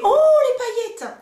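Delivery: high, slow gasp of delight; retold delight. Mother recalling first seeing the glitter, delighted. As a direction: swoon over the glitter, drawn out. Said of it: "j'ai fait".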